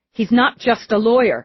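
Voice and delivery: snapped. A narrator's reading. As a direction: snap back in irritation. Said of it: "She snapped it".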